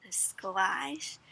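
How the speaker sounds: usual speaking voice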